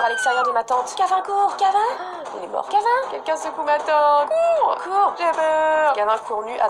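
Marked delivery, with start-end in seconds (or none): 1.0-5.1 high, loud screams for Gavin to run; panicked yelling. Real, not acted. Panicked calls to flee. 5.2-5.9 scared. Real, not acted. Scared after the chase.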